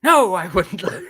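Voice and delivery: slow, high, swooping theatrical protest; hammed-up grandiose denial; ever grander character. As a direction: overplay it like a grand old actor. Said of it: "getting grander and grander with this characterization".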